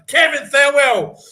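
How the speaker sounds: name belted out high and loud; gleeful announcing. Real, not acted. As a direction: shout the name in triumph. Name yelled while reading the statement.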